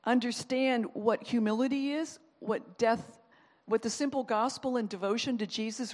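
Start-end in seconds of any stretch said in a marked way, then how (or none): none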